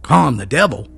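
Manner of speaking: loud, high furious bellow. A narrator's reading. Enraged mountaineer bellows at his friend, furious. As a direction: bellow it back in blind rage. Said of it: "bellowed Amos".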